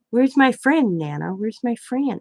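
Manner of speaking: put-on little kid's high questioning voice; Riley. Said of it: "She keeps asking me".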